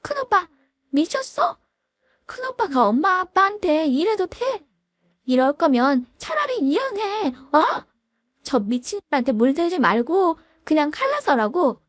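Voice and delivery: shrill high protest. Acted. Sister-in-law berates her big brother, high and sharp. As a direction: squeal accusations at big brother. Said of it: "까랑까랑한 목소리로 막 따지더군요".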